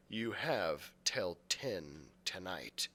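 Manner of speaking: slow, low, ominous reading; ominous message read. A narrator's reading. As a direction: read the threat slowly, darkly.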